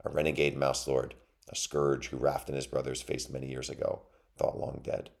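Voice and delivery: ordinary delivery, nothing marked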